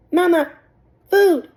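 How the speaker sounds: put-on high, loud baby-bird peep; a hungry hatchling calling out; tiny gosling voice. A narrator's reading. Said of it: "said the gosling".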